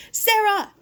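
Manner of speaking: high, loud call across the yard; shouted name. Acted. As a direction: call her name sharply. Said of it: "her uncle shouted".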